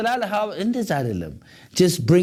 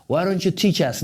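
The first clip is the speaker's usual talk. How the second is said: normal talking voice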